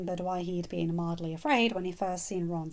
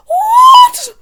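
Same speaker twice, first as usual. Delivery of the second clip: piercing high scream of horror; horrified shriek; 23 dB louder than usual. Acted. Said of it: "Molly shrieked in horror".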